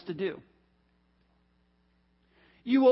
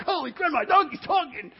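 high-pitched mock amazement; comic shock, pitched way up; astonished; 6 semitones higher, 3 dB louder than usual. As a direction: exclaim it in giddy disbelief. Astonished reaction, amid laughter.

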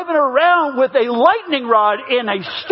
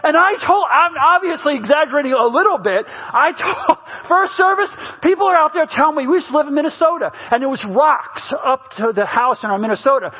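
ordinary delivery

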